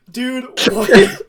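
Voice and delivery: high, loud incredulous reaction; acted disbelief. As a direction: blurt it out, about to crack up. Retold incredulous reaction, laughing.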